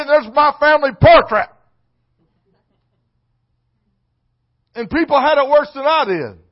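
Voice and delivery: loud, high, rising sermon delivery; preaching at full force. Acted. Preacher raising his voice to the church, loud sermon delivery. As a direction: pound each line to the congregation.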